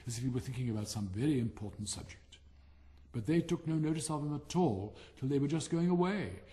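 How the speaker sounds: plain reading voice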